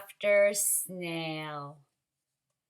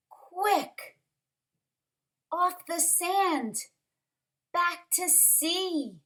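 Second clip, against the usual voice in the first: high, urgent call to hurry; urgent cry; 7 semitones higher, slower than usual. A narrator's reading. Snail urging the beached whale, urgent cry. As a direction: call out in alarm to save him. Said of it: "cried the snail".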